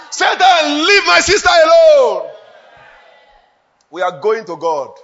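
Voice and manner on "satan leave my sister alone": higher, loud command to Satan; loud rebuke. Shown as a demonstration. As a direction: shout Satan away from a brother. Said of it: "we are getting skill in the spirit".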